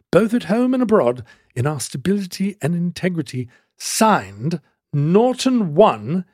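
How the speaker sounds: bad British accent. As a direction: proclaim it formally in a shaky attempt at British. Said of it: "no idea what that accent was".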